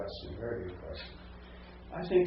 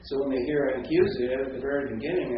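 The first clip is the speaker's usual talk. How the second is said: ordinary talk, nothing marked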